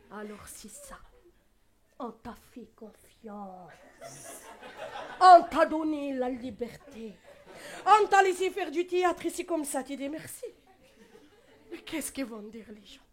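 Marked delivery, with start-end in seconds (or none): 2.0-9.8 slow then loud guilt-tripping; reproachful mother. Retold or imitated. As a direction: play the wounded mother.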